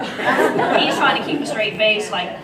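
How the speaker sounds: can't stop laughing. Real, not acted. Describing Papa Joe's straight face, laughing hard. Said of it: "I'm laughing and laughing".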